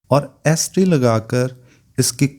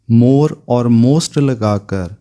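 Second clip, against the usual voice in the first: more और most spelt out slowly, each word leaned on; slow emphasis; 4 dB louder, much slower than usual. Shown as a demonstration. Teacher driving home the rule to pupils, slow and emphatic. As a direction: stretch out more और most so students catch it. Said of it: "teacher stresses rule words".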